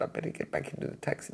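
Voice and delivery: quick nervous whisper; hushed, fast second thoughts; whispering to herself about fleeing. Retold or imitated. Nervous thought outside taxi.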